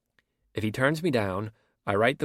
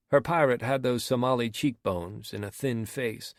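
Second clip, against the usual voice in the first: usual speaking voice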